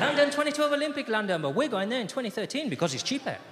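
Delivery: put-on excited holiday pitch in Spanish accent. Spanish father telling son about London trip, Spanish accent. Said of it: "Spanish accent".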